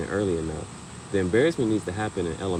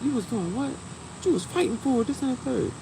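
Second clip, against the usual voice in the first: high, mock-outraged parent scolding; fake mad; 6 dB quieter than usual. Parent faking anger at the principal's office. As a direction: put on a show of anger for the principal. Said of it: "you gotta fake mad in front of the principal".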